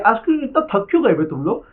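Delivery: loud, high teasing scold at lazy students; scolding the class. Real, not acted. Teacher scolds students for being sluggish before exam, loud scolding. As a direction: raise your voice and tell them off.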